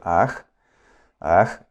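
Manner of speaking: alarga la vocal de "aj"; aj alargado. Shown as a demonstration. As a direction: di "aj" estirando la a con golpe glotal. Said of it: "hay que decirlo alargado". ("aj" largo, con cierre glotal al final)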